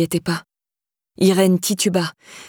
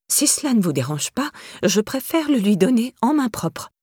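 a touch of prim, tight politeness; lightly voiced, stiffly polite old lady; 4 semitones higher than usual. A narrator's reading. Irène refuses Théo politely, in a prim tone. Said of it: "d'un ton pincé".

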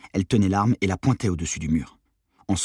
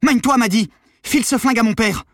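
high, very loud yelled order; urgent shout; 8 dB louder than usual. A narrator's reading. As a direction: shout to hurry her along. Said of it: "Je criai". (cri pressant)

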